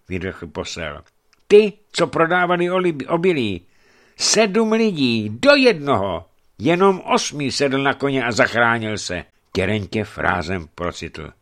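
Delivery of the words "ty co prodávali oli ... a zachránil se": urgent report. Acted. Messenger reports the massacre.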